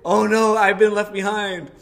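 loud, high, hammed-up panic; retold full panic. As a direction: wail it out in mock panic. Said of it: "then full on panic mode".

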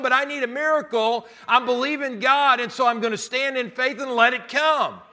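loud, high, driving sermon climax; full-force preaching. Acted. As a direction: preach it out with everything.

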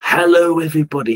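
ordinary talk

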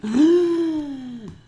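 high loud mock gasp. Vlogger reacting to bureaucratic speech with mock gasp. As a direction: gasp in fake horror.

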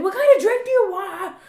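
loud, high mock shout of a question; a date yelling his drink order across the bar; imitating his yelling. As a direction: belt it out like a guy who can't use an indoor voice. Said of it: "he's yelling".

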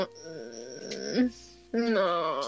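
low, soft dismissive mm no; flat refusal. Retold or imitated. Teller rejecting the younger boy, low and dismissive. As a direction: drop it down and shut him out. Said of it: "Y yo como".

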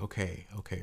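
soft, hushed "Okay, okay."; calm the child gently; soft soothing. Retold or imitated. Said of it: "I was like".

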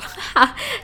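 a short high laugh. Real, not acted. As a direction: burst out laughing at yourself. Laughs at own disgust.

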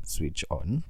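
ordinary talk, nothing marked

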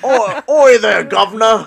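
slow, loud, high bad Australian accent; over-the-top fake Australian yell; mock Australian accent. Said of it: "I wish I could do an Australian accent".

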